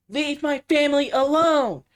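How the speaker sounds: high, loud, slow drawn-out yell; mock screaming yell. The daughter yelling at the creature, imitated high loud yell. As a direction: scream it at the creature like the film's girl. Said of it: "And she goes".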